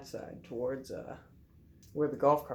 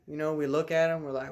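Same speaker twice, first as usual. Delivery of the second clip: ordinary talk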